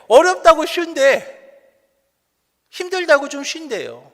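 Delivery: high, loud preacher's rebuke; exasperated preaching. Real, not acted. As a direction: scold the congregation for easing off prayer. Preaches at full force, rebuking. (높고 큰 소리로 꾸짖는 설교)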